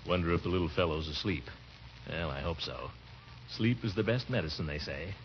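put-on soft, low musing so as not to wake the boy; hushed to himself. Uncle muses alone while nephew sleeps, soft and low. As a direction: murmur quietly to yourself. Alone at home, kid asleep.

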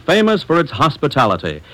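normal talking voice

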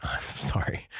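ordinary talk, nothing marked

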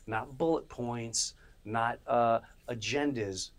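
ordinary talk, nothing marked

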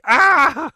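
high mock scream of pain; a short hammed-up yell. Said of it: "screaming like".

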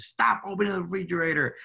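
imitating his frustrated mom snapping at him. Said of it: "She said".

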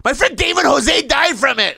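high-pitched outraged yell; heated mock shout. Narrator lying about dead friend, heated shout. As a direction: holler it back in a mock argument. Acted quarrel, shouted lie.

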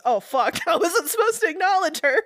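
high, giggling panicked quote; laughing through a mock panic. Quoting him while laughing.

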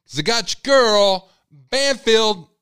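loud and high, presenting the guest like a showman; loud showman reveal; big hype announcement. Real, not acted. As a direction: shout out the introduction. Announcing the video like a host.

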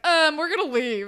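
high, loud awkward excuse; mock awkward announcement; awkward exit. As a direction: make a hammed-up excuse to get away. Recap acting out friends' awkward exit.

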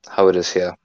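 usual speaking voice

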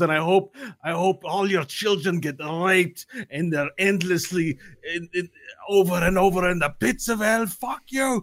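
high-pitched, climbing rant of curses; acted raging tirade from a falling man; enraged yelling. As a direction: curse them all, pitch climbing to the end. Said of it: "he's yelling up".

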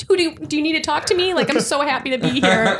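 high, gushing mock delight; imitating starstruck eagerness. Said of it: "I'm like".

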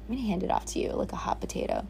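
quick whispered rush; fast whisper. Retold or imitated. Handing her burdens off to the universe, fast whisper. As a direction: whisper it fast, getting rid of it. Retold prayer.

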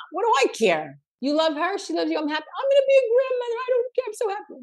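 high-pitched mock mom, giddy with joy; overjoyed mother. Mother brushing off that she's not Italian, overjoyed. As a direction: climb into a high, delighted gush. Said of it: "And the mother says".

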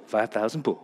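flat reassuring instruction, low; deep calm instructor. Retold or imitated. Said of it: "He goes".

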